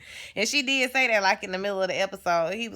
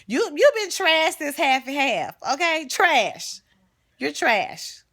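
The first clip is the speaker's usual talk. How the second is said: sassy put-down; 6 semitones higher, 5 dB louder, slower than usual. Real, not acted. Calling Rhonda trash on TV.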